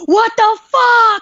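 high, loud, slow panicked wail; scared stiff by the moving can; freaked-out cry. Retold or imitated. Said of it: "I was like".

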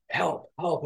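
high, unforced cry for help; radio for help in pretend panic; retold distress call, not shouted; mild mock panic.

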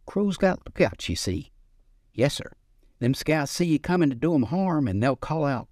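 put-on old Southern grandpa, gently drawled; lightly voiced old grandfather. A narrator's reading. Old man voiced in story.

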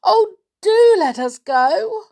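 high, slow, loud crying plea; tearful pleading. A narrator's reading. Peggy crying, begging the old woman for release. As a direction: beg for your life through tears. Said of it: "beginning to cry".

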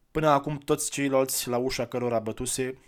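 ordinary delivery

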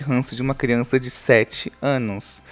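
ordinary talk

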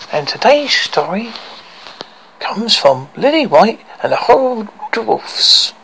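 put-on high, sing-song kids' host; reading to children. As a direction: talk to little kids gently. Host addresses kids at story time.

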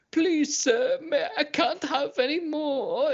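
high, slow whimpering plea; mock begging cry. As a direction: whimper it like a terrified captive, half laughing. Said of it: "He's like".

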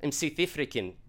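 badly faked South African accent; shaky grasp of a South African accent. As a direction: attempt South African with a Scottish comic tinge. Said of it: "Sounds like Mike Myers doing a South African".

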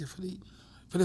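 ordinary delivery

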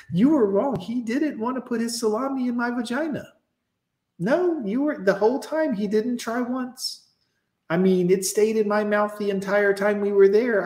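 put-on high-pitched girl protesting innocence; girl in denial. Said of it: "And she'll be like".

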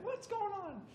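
parents asking. Retold or imitated. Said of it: "They're like".

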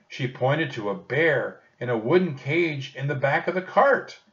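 ordinary delivery

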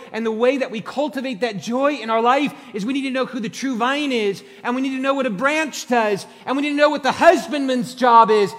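loud, high, driving sermon delivery; full-force preaching. Acted. Pastor urging the congregation, full-force preaching.

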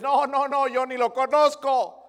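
loud, high, slow frantic no; frantic denial. Retold or imitated. Pastor acting Peter denying Christ, loud and high. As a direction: panic as if caught.

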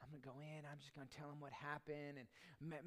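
very soft, to herself; quiet resolve. Retold or imitated. Wife quietly planning to confess at the apartment office, very soft. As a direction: murmur the plan under the breath. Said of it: "She's like".

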